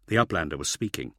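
ordinary delivery

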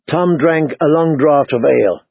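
ordinary delivery

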